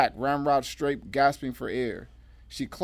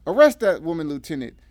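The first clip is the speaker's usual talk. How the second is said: loud, high urgent command; ordering; 5 semitones higher, 9 dB louder than usual. A narrator's reading. Deputy D A demanding the lieutenant arrest Emily, ordering. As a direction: order it at full voice. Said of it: "ordered the D A".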